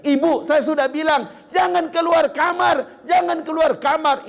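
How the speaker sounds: put-on high, loud furious shout at the old mother; angry scolding. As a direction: scold her hard, repeating the order. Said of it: "ibunya yang dia salahkan".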